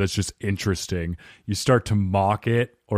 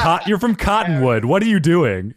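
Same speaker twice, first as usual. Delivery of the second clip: higher-pitched teasing protest; mock exasperation; 7 semitones higher than usual. Husband ribbing his wife over her accent, mock exasperation. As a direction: call out the accent in mock disbelief.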